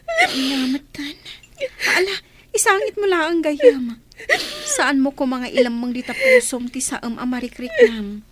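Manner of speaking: tearful pleading; weeping while comforting; crying. Acted. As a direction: speak through tears, voice high. Consoling crying woman.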